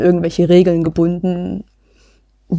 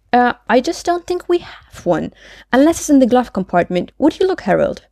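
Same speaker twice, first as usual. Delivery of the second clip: higher, lilting character voice; Maud, obliging and unworried; 6 semitones higher than usual. Acted. Maud asked for registration, asking Harold to look, airily. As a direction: answer high and helpfully, unworried. Reads Maud's part in dialogue.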